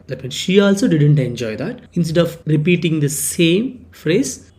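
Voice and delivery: ordinary talk, nothing marked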